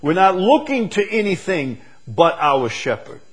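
high, loud building preaching; preaching at full force. Real, not acted. Preacher declaring dependence on the shepherd, high and loud. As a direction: build the fire up to the end.

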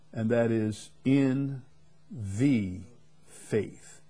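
usual speaking voice

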